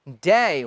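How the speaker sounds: डे as a low, falling, drawn-out day glide; lengthened DAY. Shown as a demonstration. Teacher corrects students' short डे, low long gliding day. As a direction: stretch डे into daay. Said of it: "डे को क्या कहना है?".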